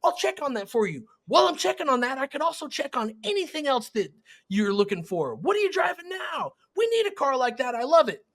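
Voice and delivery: high, excited, half-shouted pitch; eager salesperson. Shown as a demonstration. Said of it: "Would you rather have".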